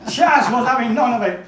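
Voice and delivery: high, loud declaiming; indignant oratory. Acted. Reader launching into Chas having none of it, loud indignant declaiming. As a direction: boom it out like a riled speaker. Reader resumes the ranting Dickens scene.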